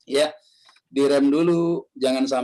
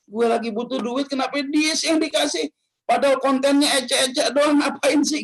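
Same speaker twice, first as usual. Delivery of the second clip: envious grumble; 11 semitones higher than usual. Retold or imitated. Envious viewer complains.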